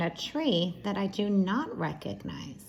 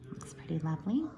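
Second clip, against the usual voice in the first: soft whisper; 3 semitones lower, 6 dB quieter, much faster than usual. Real, not acted. Aside while reading picture book.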